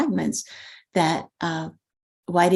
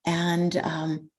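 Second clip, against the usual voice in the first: usual speaking voice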